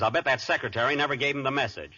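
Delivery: ordinary delivery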